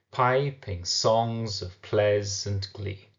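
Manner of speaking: slow PI-ping SONGS of PLEA-sant GLEE; "piping songs of pleasant glee" drawn out in alternating beats; stressed-unstressed beat. Shown as a demonstration. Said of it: "one stressed, followed by one".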